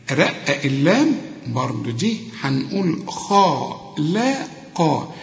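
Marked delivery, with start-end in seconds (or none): 3.1-5.1 خا لا قا slowly, rounded lips on خا and قا; syllable drill. Shown as a demonstration. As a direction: spell out خا لا قا, lips rounded then smiling. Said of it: "مديلي شفايفك على هيئة قبلة".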